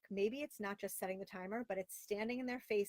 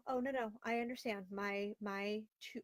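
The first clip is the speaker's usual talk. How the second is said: normal talking voice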